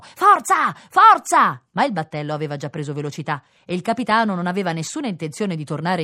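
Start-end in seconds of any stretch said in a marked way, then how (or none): 0.0-1.6 loud, high urging yell; shouted cheering. A narrator's reading. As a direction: cheer the swimmer on. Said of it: "passeggeri e turisti gridavano". (incitamento gridato a gran voce)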